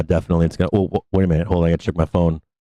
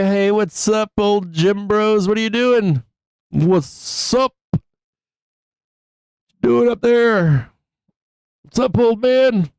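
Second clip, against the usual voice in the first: taunting gym bros; 11 semitones higher, slower than usual. Pretend. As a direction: tease the old gym bros, drawn out. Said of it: "We're gonna say".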